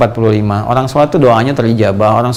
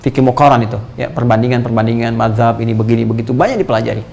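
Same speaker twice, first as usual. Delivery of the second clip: ordinary delivery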